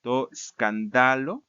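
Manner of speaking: "escándalo" said slowly as skan-DA-lo; measured Greek recitation, "escándalo" stressed on DA; stress shifted to penult. Shown as a demonstration. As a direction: lean on DA in "escándalo". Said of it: "a la penúltima sílaba".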